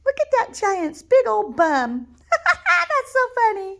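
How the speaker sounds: high, loud giggling delight; laughing at the giant. Acted. Reader laughs at the fallen giant, giggling. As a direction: cackle at the silly sight.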